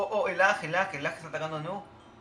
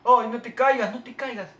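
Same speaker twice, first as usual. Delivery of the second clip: high, urgent cry starting loud; panicked pleading; 7 semitones higher, 10 dB louder than usual. Real, not acted. Player begging the ball not to fall, panicked cry. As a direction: beg it desperately, voice up high.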